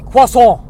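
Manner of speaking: croissant suddenly loud and French, kwa-SOH. Acted. Customer ordering tea and peanuts, jarringly loud French croissant. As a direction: burst out with an overdone French croissant, no final t. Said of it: "the only French way I say it".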